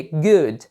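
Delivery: GOOD in English, one syllable ending on D; correct pronunciation of good. Shown as a demonstration. Teacher shows learners the word good, correctly pronounced. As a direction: say "good" with no vowel after the final D. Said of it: "eu falei com a pronúncia certa".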